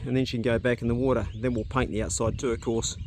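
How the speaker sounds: normal talking voice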